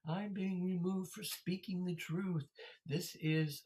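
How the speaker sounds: high-pitched mock martyr wail; hammed-up righteous complaint; mock indignant protest. Imitating removed preacher's protest.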